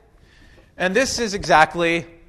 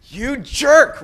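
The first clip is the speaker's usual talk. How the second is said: mock road rage; 8 semitones higher, 6 dB louder than usual. Angry driver cut off on highway.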